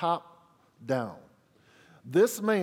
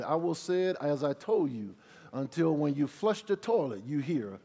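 normal talking voice

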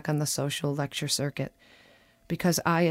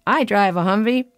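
louder, higher imitation of a grinning man; grinning boast; 4 semitones higher, 7 dB louder than usual. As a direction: boast it with a big grin. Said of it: "The man says with a grin".

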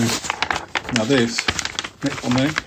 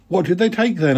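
put-on a boy's eager question pitched higher; higher schoolboy voice; 7 semitones higher than usual. Dick asking Tom what was stolen, higher boy voice. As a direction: ask it like a keen young boy. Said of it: "asked Dick".

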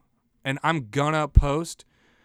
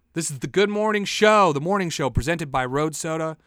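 announcer voice; 3 semitones higher, 4 dB louder than usual. Acted. Show intro announcement.

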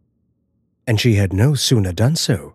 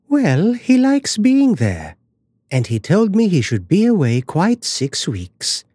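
airy wife; 9 semitones higher than usual. Acted. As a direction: brush his worry away in a raised voice. Wife brushes off husband's worry.

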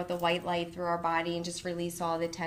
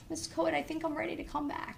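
put-on higher, quicker small-girl voice, lightly done; girl tells her teacher she is ready, higher and quicker; little girl's voice; 9 semitones higher, 3 dB quieter, faster than usual. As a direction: play the calmed-down little girl. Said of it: "She said".

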